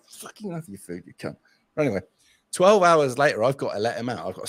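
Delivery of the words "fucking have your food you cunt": acted-out hushed cursing; wary feeding. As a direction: curse very softly at the cat, keeping well back. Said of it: "I'm like this".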